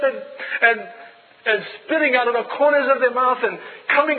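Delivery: loud, high fervent preaching. Acted. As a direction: proclaim it to the congregation. Preaching at full force.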